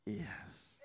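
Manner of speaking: faint whispered yes. Retold or imitated. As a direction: breathe the word out. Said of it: "She's like".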